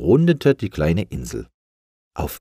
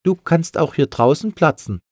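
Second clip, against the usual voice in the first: high, louder menacing gull; threatening voice; 10 semitones higher, 5 dB louder than usual. Acted. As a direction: taunt the balloon from behind. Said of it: "sagte eine drohende Stimme". (drohende Möwenstimme)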